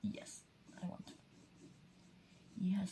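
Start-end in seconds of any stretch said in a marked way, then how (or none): 0.0-1.1 whispering. Real, not acted. Quiet remarks to self while drawing.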